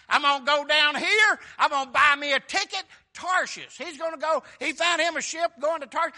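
high, loud, driving sermon storytelling; full-force preaching. Real, not acted. Preacher telling of Jonah's flight to Tarshish, full-force preaching. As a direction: belt the story out from the pulpit.

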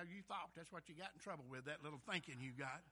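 ordinary talk

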